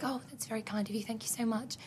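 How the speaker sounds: soft, sheepish thank-you; demure thanks. Retold or imitated. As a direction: say it softly, a little guilty. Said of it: "And I'd be like".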